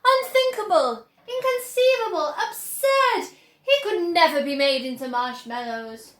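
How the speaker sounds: exclaiming. A narrator's reading. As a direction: pile up the protests. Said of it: "cried Mister Wonka".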